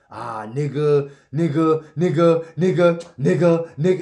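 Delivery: loud, forced repeated shouting; forced mock shouting. Mocking how others force the word, loud shouts. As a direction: overdo it, shout every word. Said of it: "they force it".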